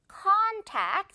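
contact with a high, strong CON; stress on CON. Shown as a demonstration. Teacher reminds learners, front-stressed contact. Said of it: "con의 강세 주세요".

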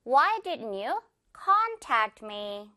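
very slow question with a strong CON in contact; stress on CON. Shown as a demonstration. Teacher models for repetition, slow front-stressed contact. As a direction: stretch con, let tact me fall away. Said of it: "con의 강세 주세요".

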